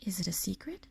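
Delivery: whispered question. A narrator's reading. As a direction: breathe it like a secret. Lucy asks in hush.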